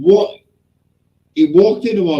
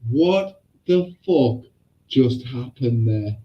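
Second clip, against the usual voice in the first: slow, spaced-out disbelief; stunned teacher; 4 semitones lower, 4 dB quieter, slower than usual. Retold or imitated. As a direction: imitate a teacher drawing out each word. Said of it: "our teacher went".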